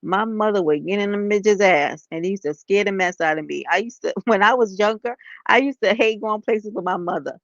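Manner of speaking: normal talking voice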